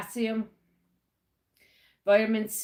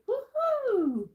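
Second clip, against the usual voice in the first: excited cheer. Real, not acted. Cheering into the morning.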